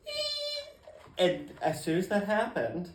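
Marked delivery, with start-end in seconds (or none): 0.1-0.7 startled high-pitched squeak; tiny shocked squeak. Retold or imitated. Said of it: "I'm like".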